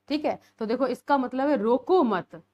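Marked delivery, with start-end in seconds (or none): 1.6-2.4 रोको मत louder, as one firm phrase; stop after मत. Shown as a demonstration. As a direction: stress रोको मत together, falling at the end. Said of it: "रोको मत के बाद लगा दिया".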